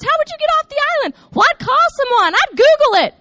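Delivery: imitating girls firing off questions, high and fast; loud, high-pitched mock brainstorming; eager questioning. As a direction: blurt out ideas like excited kids. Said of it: "we were asking questions".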